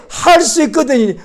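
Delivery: slow, drawn-out mock of a doubtful phrase; mocking quote. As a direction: stretch the words to ridicule them. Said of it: "아니".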